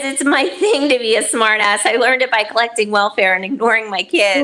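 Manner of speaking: loud, fast read-out. Acted. Reading a chat comment loudly.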